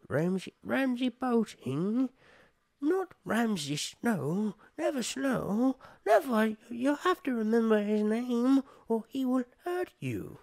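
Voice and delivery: high, slow, strained creature voice; Smeagol voice. Acted. Redoing Theon's line as Smeagol would, high tormented whine. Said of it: "do it like a Smeagol".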